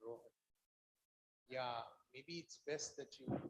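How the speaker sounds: very faint voice, far from the mic; almost inaudible speech; barely audible. Real, not acted. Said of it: "your voice is very low".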